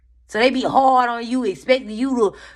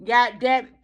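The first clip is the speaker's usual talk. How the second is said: scolding shout; 4 semitones higher than usual. Acted. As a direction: snap at yourself to stop. Scolding herself, acted.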